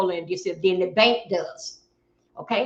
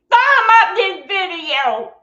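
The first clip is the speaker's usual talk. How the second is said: high, loud, stretched-out holler; calling out; 9 semitones higher, 8 dB louder, slower than usual. Real, not acted. Streamer asking viewers to thumb up the video, calling out. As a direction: holler the request slowly to everyone.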